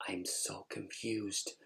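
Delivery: hushed, slow aside to yourself; confused inner thought. Retold or imitated. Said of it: "And I'm thinking".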